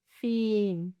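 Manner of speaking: FIN with a long, held "ee"; slow, sustained "fin" for the listener; drawn-out demonstration. Shown as a demonstration. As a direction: stretch the vowel in "fin". Word stretched for listening practice.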